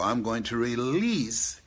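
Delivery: slow, weighty "release"; stress on release. Shown as a demonstration. Repeating his point to the audience, stressing "release". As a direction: press down on "release". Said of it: "not lose".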